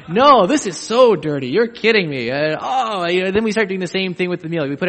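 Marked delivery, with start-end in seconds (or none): 0.1-2.3 louder, playful protest; mock protesting host. Preacher plays a host denying the clean house, louder. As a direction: act out the fussy host brushing off praise.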